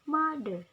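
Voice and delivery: "Mother" with MO punched loud, falling after; stress on first syllable. Shown as a demonstration. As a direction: model "Mother" with a strong MO. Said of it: "at the ma".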